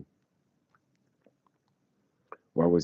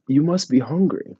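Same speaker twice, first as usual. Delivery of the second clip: high, louder motherly offer; imitating a caring host; mock kindly host; 9 semitones higher, 5 dB louder than usual. Couple offers food.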